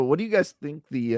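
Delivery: usual speaking voice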